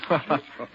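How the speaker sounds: short amused laugh; a light chuckle at the joke. Acted. Friend laughing off a teasing remark, amused chuckle.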